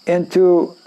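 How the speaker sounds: ordinary talk, nothing marked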